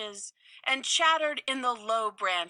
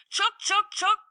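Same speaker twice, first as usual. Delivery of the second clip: high chattering squirrel scold; sharp high squirrel chuks; 11 semitones higher, 4 dB louder than usual. Acted. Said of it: "said twenty other Mama Squirrels".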